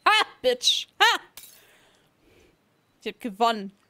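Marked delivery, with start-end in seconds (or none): 0.1-1.2 gloating. Real, not acted. Gloating after winning a game.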